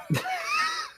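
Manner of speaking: high shrieking villain laugh; wild high cartoon cackle; maniacal cackle. Real, not acted. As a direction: cackle like the Goblin. Laughing after villain impression.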